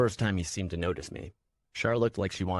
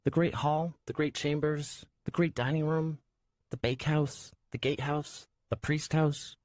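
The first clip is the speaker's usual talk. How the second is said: high, weary listing; sighing girl voice; 9 semitones higher than usual. A narrator's reading. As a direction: list them with a tired sigh. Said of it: "she sighed".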